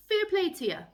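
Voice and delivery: high, loud bright praise; cheery Irish praise. Shown as a demonstration. Praising a friend's good job, loud and high. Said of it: "if someone says".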